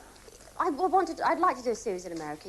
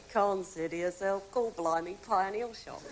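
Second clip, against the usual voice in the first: Cockney; 4 semitones lower, slower than usual. Retold or imitated. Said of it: "so then I got a bit".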